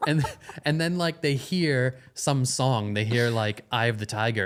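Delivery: usual speaking voice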